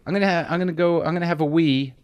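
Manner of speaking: imitating polite Brits; lightly mocking British grown-up voice. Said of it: "they literally say".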